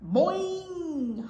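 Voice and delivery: high, loud boing, stretched out; soaring bounce sound; big high bounce. A narrator's reading. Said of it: "How about this?".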